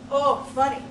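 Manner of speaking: ordinary delivery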